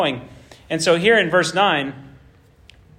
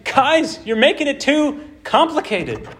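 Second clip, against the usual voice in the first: exasperated plea; 9 semitones higher than usual. Acted. Prophet pleading with the people.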